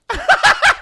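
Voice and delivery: high, loud cackle; short piercing burst of laughter; real high-pitched laugh. Real, not acted. Laughing at the drink.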